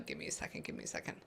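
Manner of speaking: voiceless whisper asking for a moment; whispered request. Real, not acted. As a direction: hush while you focus. Reader concentrating, asks for quiet.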